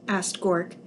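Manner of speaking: usual speaking voice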